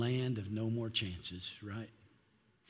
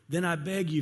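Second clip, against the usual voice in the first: higher, louder plea; begging; 5 semitones higher, 4 dB louder than usual. Acted. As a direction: beg with everything left. Rich man begs Abraham.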